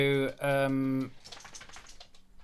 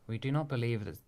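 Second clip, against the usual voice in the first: lightly voiced Simeon; 5 semitones lower, 7 dB quieter than usual. GM voices Simeon defending Eyeless.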